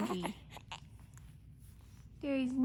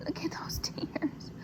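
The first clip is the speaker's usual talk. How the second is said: whisper; 2 semitones higher, 5 dB quieter than usual. Real, not acted. Parent whispering to crying baby.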